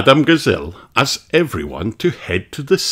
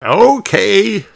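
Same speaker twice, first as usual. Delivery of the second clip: higher, louder cheery okay; Grandpa Pig's voice; 12 semitones higher, 5 dB louder than usual. Acted. Grandpa agreeing to Peppa, cheery. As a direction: agree like a game old pig. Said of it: "says Grandpa Pig".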